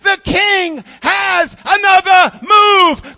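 very loud, high triumphant shout; excited yell. Acted. Chess master shouting his discovery to the returning group, excited yell. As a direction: holler it with every word punched. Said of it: "he's all excited".

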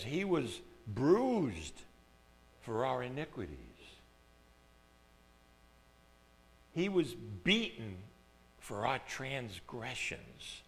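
hushed recitation; solemn scripture reading. Acted. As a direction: weigh each phrase of the prophecy. Reading Isaiah slowly.

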